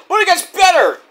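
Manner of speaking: high, loud burst of excitement; gleefully over-the-top exclamation; excited hype. Real, not acted. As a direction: sell the next twist with a big shout. Host hyping the next twist.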